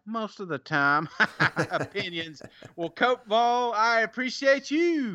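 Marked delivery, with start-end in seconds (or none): 3.7-5.2 showy sign-off to a reviewer; catchphrase flourish. Real, not acted. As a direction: belt out your catchphrase, pitched way up then falling. Same catchphrase to next reviewer.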